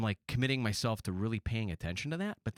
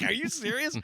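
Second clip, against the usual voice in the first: mock disbelief; 3 dB louder than usual. Shocked at bridesmaid's jab.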